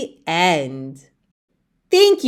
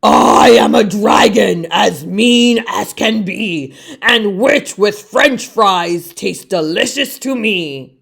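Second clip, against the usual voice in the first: a louder, menacing dragon voice; hammed-up dragon roar; 3 dB louder than usual. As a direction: boom it out like a greedy dragon. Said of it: "a roar that was scary and loud".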